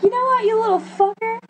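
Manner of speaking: high-pitched indignant rant; retold angry freak-out. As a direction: retell telling off a kid in a high, worked-up voice. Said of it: "screaming at this kid".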